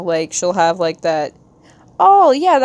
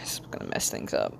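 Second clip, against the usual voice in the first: hushed fast whisper; 14 dB quieter, faster than usual. Real, not acted. Streamer whispering an aside while drawing. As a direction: mutter it under your breath.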